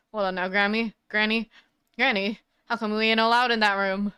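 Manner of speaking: put-on Southern accent. Southern photographer questions granny.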